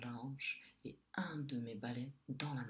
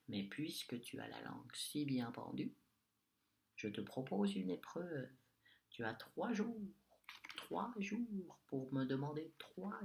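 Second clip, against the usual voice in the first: witch voice; 3 semitones higher than usual. Acted. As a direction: lay out the challenge with sly menace. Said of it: "La sorcière me répond".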